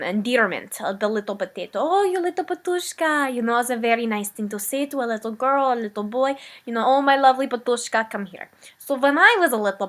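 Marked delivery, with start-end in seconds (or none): 1.8-3.3 put-on high, cooing mother voice in the Eastern European accent; doting endearment. [name] shows how a mother fondly calls a child her little potato, high cooing Eastern European accent. 6.9-8.5 fond call. Retold or imitated. Voicing an endearment to child.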